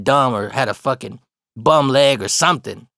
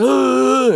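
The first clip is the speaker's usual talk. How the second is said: long, high-pitched mock groan; imitated groan; 11 semitones higher, 5 dB louder than usual. Blind guy bursting into the restroom, imitated groaning. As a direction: groan loudly like someone stumbling in.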